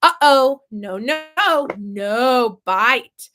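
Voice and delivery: a loud, sharp uh-oh, then firm no no; loud simple warning to a toddler. Shown as a demonstration. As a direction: stop him biting with short firm words. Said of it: "but we say".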